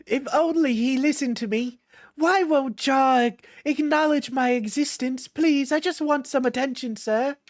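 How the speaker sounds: high, needy mock plea; mock whiny plea. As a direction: whine like you're begging for attention. Mocking Jar pleading for attention.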